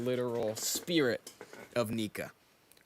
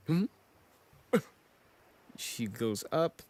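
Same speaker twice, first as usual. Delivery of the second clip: ordinary talk, nothing marked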